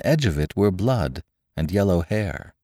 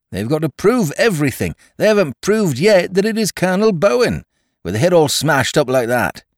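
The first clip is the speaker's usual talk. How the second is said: high, loud busybody voice; officious excitement; 11 semitones higher, 4 dB louder than usual. A narrator's reading. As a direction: insist eagerly, over-keen. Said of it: "in officious excitement".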